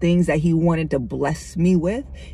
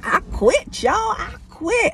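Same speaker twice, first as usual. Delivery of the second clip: high-pitched, fed-up outburst; exasperated I quit; 13 semitones higher than usual. Retold or imitated. Telling the congregation she gave up, a high exasperated cry. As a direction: throw your hands up and cry out you're done.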